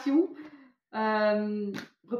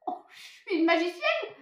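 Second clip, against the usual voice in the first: excited; 9 semitones higher than usual. Real, not acted. Proud of her reading.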